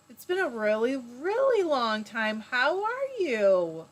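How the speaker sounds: sincere slow reunion words; heartfelt slow greeting. Real, not acted. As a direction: draw it out with real warmth. Heartfelt reunion with old friend.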